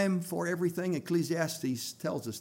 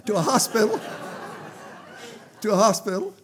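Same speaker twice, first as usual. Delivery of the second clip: laughing; 5 semitones higher, 4 dB louder than usual. Real, not acted. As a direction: say it while laughing at the slip.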